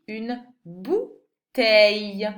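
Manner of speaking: "oreille" drawn out slowly, stressed; slow stressed word. Shown as a demonstration. Teacher to pupil, naming the picture slowly. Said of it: "helping with the pictures".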